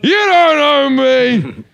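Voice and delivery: loud, high, very slow mock outrage; mock indignant rant. Comedian reenacts yelling back at a heckler, loudly and slowly. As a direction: ham up a wounded protest. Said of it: "And I did that".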